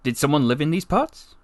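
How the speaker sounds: usual speaking voice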